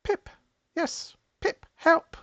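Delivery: very high little creature voice; bell-like voice. Acted. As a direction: chirp it high like a tiny bell. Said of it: "in a bell-like voice goes".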